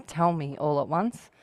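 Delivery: ordinary talk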